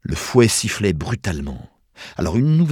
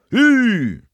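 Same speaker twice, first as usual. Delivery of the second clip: high, loud driver's yell; loud urging holler; shouted command; 7 dB louder than usual. A narrator's reading. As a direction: shout to get a dog team moving. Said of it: "cria-t-il".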